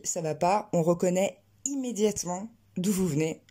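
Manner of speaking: ordinary delivery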